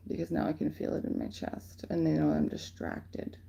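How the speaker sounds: hushed tense murmur; whispered aside. Real, not acted. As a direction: whisper as if being watched. Feels attack in her chest.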